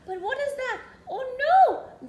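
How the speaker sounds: high question rising into loud dismay; mock alarm. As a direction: discover the disaster with theatrical shock. Storyteller spots running water.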